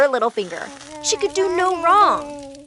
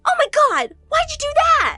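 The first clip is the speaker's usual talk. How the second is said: much higher, loud horrified yell; shocked cry; 14 semitones higher, 6 dB louder than usual. A narrator's reading. Julie horrified as her sister cuts her curls, high and loud. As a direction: gasp out in horror.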